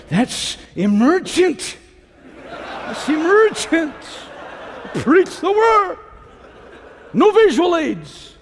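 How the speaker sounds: high, slow mock outrage; hammed-up disapproval, pitched high; mock objection. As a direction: play the scandalized objector, drawing out each phrase. Acting out objector to overhead projectors.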